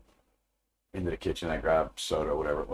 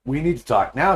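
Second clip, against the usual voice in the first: raised, firmly insisting voice; insistent demand; 5 semitones higher, 6 dB louder than usual. Retold or imitated. Wife demanding her husband talk now, raised and insistent. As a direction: press hard for the talk right now.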